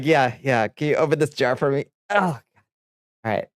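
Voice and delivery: normal talking voice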